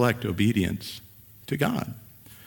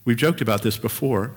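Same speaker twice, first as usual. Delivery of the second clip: normal talking voice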